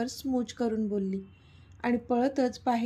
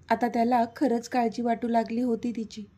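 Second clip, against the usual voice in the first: ordinary delivery, nothing marked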